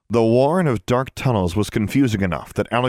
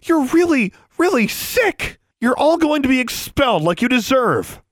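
high, heated denunciation; furious condemnation; 3 dB louder than usual. A narrator's reading. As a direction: tell them off with voice pitched way up. Furious girl berates the society.

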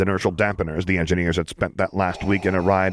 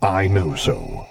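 a lightly voiced Vader, very slow and certain; Vader's voice; much slower than usual. A narrator's reading. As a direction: draw it out calmly like the dark lord. Vader answers his admiral.

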